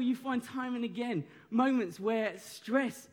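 ordinary talk, nothing marked

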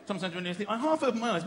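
ordinary delivery

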